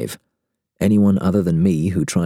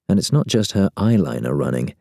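usual speaking voice

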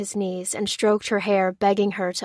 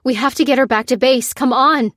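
loud, high, pressing urgency; raised, insistent rallying call; urging; 5 semitones higher, 7 dB louder than usual. A narrator's reading. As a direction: urge them to move now. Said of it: "urged Lucy".

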